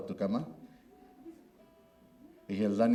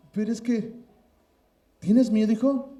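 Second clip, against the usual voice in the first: higher, louder mock-concerned question; teasing father; 9 semitones higher, 6 dB louder than usual. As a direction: needle the boy about his fear. Father teasing scared son. (burla de padre)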